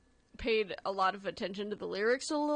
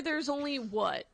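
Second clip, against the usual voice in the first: ordinary talk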